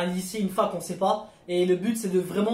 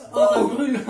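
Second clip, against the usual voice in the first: laughing; 4 semitones higher, 6 dB louder than usual. Real, not acted. Eating a hot chili, laughing.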